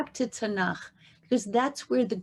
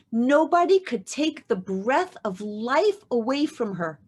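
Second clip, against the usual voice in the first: normal talking voice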